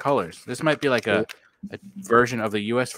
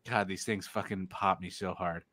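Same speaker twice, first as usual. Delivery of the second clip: usual speaking voice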